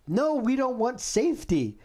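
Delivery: high mocking politician voice; sarcastic impression of a leader; mocking quote. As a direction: parody them refusing safety.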